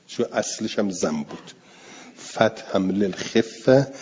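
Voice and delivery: normal talking voice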